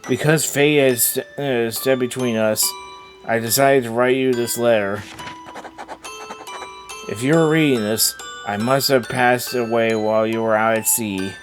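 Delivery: tender letter reading. Acted. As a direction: read a dead wife's letter gently. Reads the dead wife's letter.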